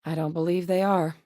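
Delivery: usual speaking voice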